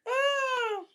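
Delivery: amused squeal; high laughing cry. Real, not acted. Laughing reaction to chat.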